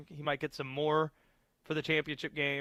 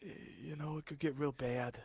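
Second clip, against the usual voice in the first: put-on toned-down Jersey accent, softer; overdone regional accent; 6 dB quieter than usual. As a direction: lighter New Jersey accent. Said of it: "That's better".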